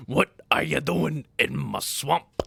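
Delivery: slow, drawn-out ogre bellow; Shrek impression. Streamer quoting Shrek to chat, slow ogre voice. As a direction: demand like the angry ogre.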